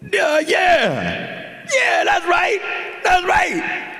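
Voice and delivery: high falsetto screech, mocking impression; meth-head woman. Woman confirms it to her boyfriend, high squealed agreement. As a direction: squeal it like an over-eager woman. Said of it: "she whispers in his ear, she's like".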